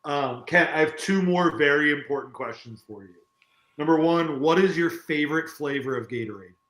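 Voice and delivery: ordinary delivery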